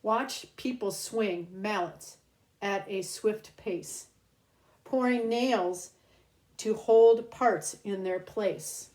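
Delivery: lilting read-aloud storybook voice; reading to children. Acted. Host reads a rhyming book to kids, storybook lilt. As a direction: read each rhyme slowly to little ones.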